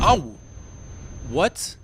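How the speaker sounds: loud, high startled yelp; shocked outburst. Real, not acted. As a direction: blurt out in total shock. Sudden death in the game.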